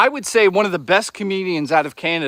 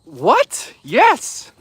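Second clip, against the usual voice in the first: startled then thrilled, yes jumps much higher; excited yes; 7 semitones higher, 3 dB louder than usual. Retold or imitated. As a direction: kid hearing he can skip French, startled then thrilled. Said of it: "I was like".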